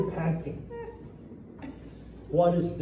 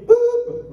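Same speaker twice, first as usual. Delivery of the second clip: high, loud "boop"; mock lunch whistle; 8 dB louder than usual. Joke teller sounds the lunch horn on the construction site, high mock whistle.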